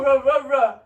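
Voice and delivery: loud mock fighting growl; repeated punchy rah sounds; play-fight wind-up roars; wind-up noise.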